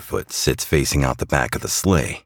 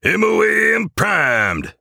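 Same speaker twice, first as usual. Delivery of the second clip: high, slow elf shout; parroting back; 4 dB louder, slower than usual. A narrator's reading. Jingleman confirms to Claus, high parroted call. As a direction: sing out confirmation in a squeaky voice. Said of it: "parrots Jingle back to Claus".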